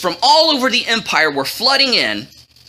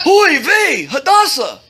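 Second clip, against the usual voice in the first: high, exclaimed worry; 5 semitones higher, 4 dB louder than usual. A narrator's reading. Worried cousin calls out to his ward, fretful. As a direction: cry out like a fretting older relative.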